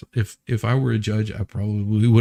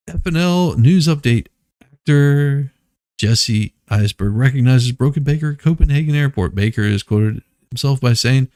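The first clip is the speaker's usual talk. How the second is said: mock news anchor; 2 semitones higher, 4 dB louder than usual. Host mock-announcing a celebrity news item, anchor style.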